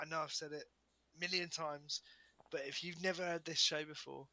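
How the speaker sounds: ordinary delivery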